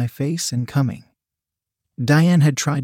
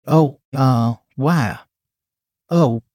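breathless unsteady moans; moaning; slower than usual. A narrator's reading. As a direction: let the moans wobble out. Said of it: "Brian moaned".

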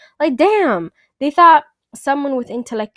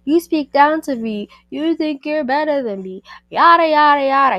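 high-pitched mocking imitation of an insecure man; insecure projecting; 6 semitones higher, 4 dB louder than usual. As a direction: mimic his touchy accusations, pitched up and louder. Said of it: "He kept on projecting".

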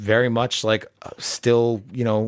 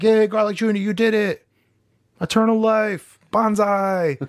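high, put-on minion cheer; cheering henchmen; 9 semitones higher than usual. Henchmen hail Garlic Junior's eternal life, high mock cheer. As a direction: cheer the boss like silly minions, pitched way up.

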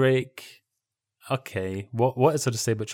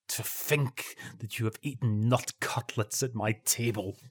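softly, slowly livid; restrained indignation, slow and quiet; Malcolm Muggeridge; 5 dB quieter, slower than usual. Acted. Host rebuking guest over nut cutlets, quietly livid. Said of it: "as close as Malcolm Muggeridge could ever get to being livid".

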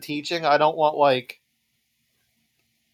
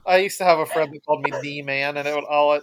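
ordinary delivery

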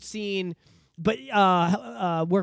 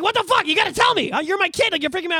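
panicked mom; 12 semitones higher, 5 dB louder than usual. Retold or imitated. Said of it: "She's like".

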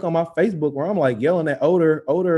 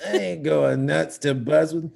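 Dean Del Rey; slower than usual. Retold or imitated. Repeating a fellow comic's bit, cartoon impression. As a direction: draw it out like a cartoon character. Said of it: "he has a cartoon voice".

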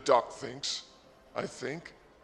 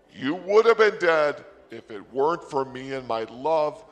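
forceful raised-voice telling-off; loud scolding; 10 dB louder than usual. Acted. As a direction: belt it out at the ungrateful duck. Acted scolding of the duck.

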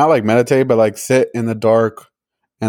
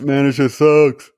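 slow, higher mock griping; mock complaint; 4 semitones higher, slower than usual. Guessing the roommates' verdict on the movie, mock complaint. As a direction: imitate a let-down viewer, drawn out.

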